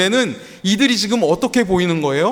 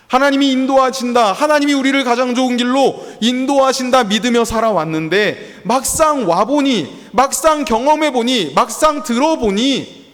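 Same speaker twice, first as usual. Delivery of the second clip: preaching climax; 8 semitones higher, 4 dB louder than usual. Acted.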